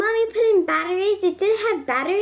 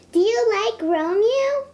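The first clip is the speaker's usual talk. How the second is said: ordinary talk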